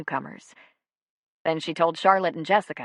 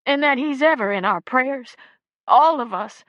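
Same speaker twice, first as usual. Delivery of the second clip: tearful; 7 semitones higher, 7 dB louder than usual. A narrator's reading. Grieving mother's message.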